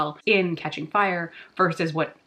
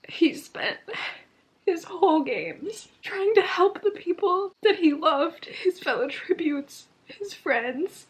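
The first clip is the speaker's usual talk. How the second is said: tearful, breath catching into a high voice; crying; 10 semitones higher, slower than usual. Real, not acted. Reader grieving over Haymitch's games, crying. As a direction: start in a choked whisper, then high and teary. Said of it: "I literally can't stop crying".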